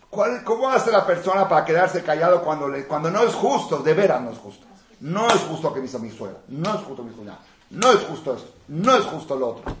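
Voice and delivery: mock complainer. Mimicking complainer.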